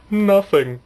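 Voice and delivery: sudden high, loud denial; the shifty stranger brushes him off; curt suspect. Retold or imitated. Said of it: "And he was all like".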